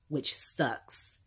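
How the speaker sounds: breathy under-the-breath comment; a quick voiceless aside; whispered aside. Real, not acted. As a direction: mutter it to yourself. Aside after sad news.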